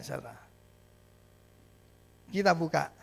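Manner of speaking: ordinary talk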